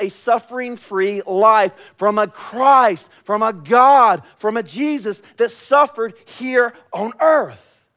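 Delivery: loud, high, impassioned sermon delivery; full-force preaching. Acted.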